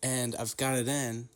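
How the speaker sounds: talking around a retainer; words blurred by a dental retainer; wearing a retainer. Real, not acted. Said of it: "I've got my retainer in".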